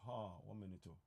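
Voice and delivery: very soft, low aside; muttering to self. Real, not acted. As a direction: talk to yourself under your breath. Muttering to self while fixing screen.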